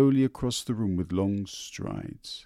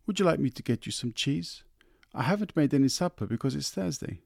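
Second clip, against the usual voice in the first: offering wife; 7 semitones higher than usual. A narrator's reading.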